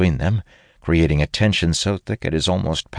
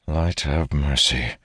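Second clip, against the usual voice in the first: plain reading voice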